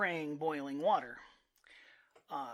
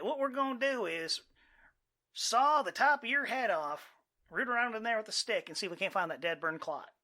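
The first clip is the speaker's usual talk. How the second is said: put-on deep Southern accent; 6 semitones higher, 6 dB louder than usual. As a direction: play a backwoods Southern surgeon in a deep Southern accent. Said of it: "it's like a deep Southern accent".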